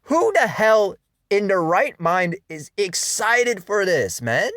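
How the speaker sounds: loud frustrated yell, drawn out; loud slow outraged cry; exasperated outburst. Retold or imitated. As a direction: vent at the hype. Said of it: "And I'm just like".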